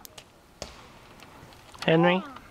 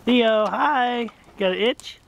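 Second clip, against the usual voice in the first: excited greeting; 7 semitones higher, 4 dB louder, much slower than usual. Real, not acted. Greeting the baby brightly.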